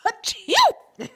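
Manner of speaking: loud high fake sneeze; sneezing. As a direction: blast out an exaggerated achoo. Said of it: "I have to sneeze".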